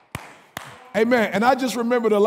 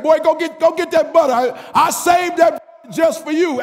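God commanding, loud; 8 semitones higher, 4 dB louder than usual. Acted. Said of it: "He said".

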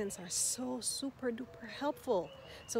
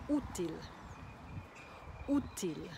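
útil with strong first Ú; teacher's model "útil", first syllable stressed. Shown as a demonstration. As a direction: hit the "ú" of útil, light til. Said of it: "accent on the U".

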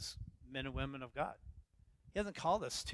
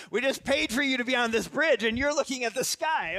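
mock scolding wife; 8 semitones higher, 7 dB louder than usual. Quoting wife scolding on bridge.

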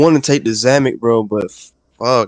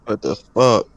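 ordinary delivery

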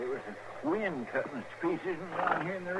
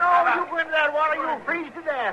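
fast, much higher and louder pleading; frantic protest; 9 semitones higher, 6 dB louder, faster than usual. Acted. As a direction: beg him not to go into the water.

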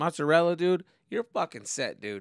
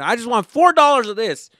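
bold, higher call for four dollars; loud order; 10 semitones higher, 15 dB louder than usual. Retold or imitated. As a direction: demand it proudly across the deli. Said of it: "So I just say the number".

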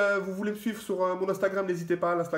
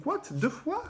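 surprised question; 14 semitones higher, slower than usual. Real, not acted. Same card pulled twice.

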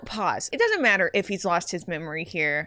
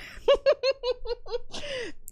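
high, quieter run of giggles; helpless giggle; 14 semitones higher, 4 dB quieter than usual. Real, not acted. Player losing it over a character's wig, helpless giggle.

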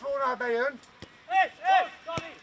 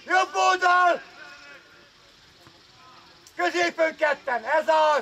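shouted coaching. Real, not acted. Coach steers the play, shouting. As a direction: call it out to distant players.

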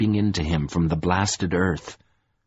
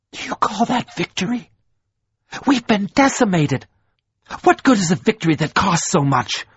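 bitter outrage; 10 semitones higher than usual. A narrator's reading. Troy protests the costly victory.

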